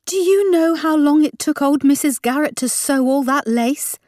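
high, indignant demand; indignant mum. A narrator's reading. Mum protesting over the burnt lace, high indignation. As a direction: demand an answer, pitched well up.

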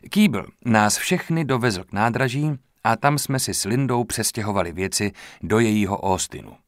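usual speaking voice

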